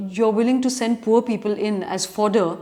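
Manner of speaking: normal talking voice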